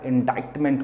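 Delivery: "इंडाइक्टमेंट" with "डाइ" said like "die"; corrected pronunciation. Shown as a demonstration. Teacher correcting a common misreading, "इंडाइक्टमेंट" with an "eye" sound. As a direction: say "इंडाइक्टमेंट" with a long "eye" in the middle. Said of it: "लेकिन वो ऐसा नहीं होता है".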